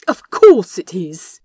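loud imploring insistence. Acted. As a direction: beg him, pressing loud. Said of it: "the angel implored".

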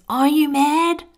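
high, loud panicked cry; mock scream. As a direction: shriek it like a frightened voice. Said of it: "My fear screams".

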